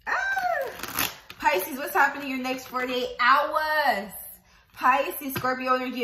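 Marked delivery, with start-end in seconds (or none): 0.1-1.1 very high excited "ah"; excited squeal. Real, not acted. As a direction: squeal with delight at the card. Reacting to the drawn card.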